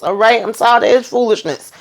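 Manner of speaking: usual speaking voice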